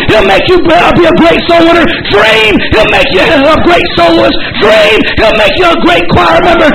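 shouted fervent preaching with high calls; rally them with every line; preaching at full force. Acted. Preacher urging young people to dream big, fervent high shouting.

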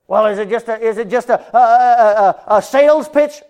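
high, loud suspicious voice; suspicious lady. Retold or imitated. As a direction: mimic a wary homeowner, high and loud. Mocking suspicious lady at door.

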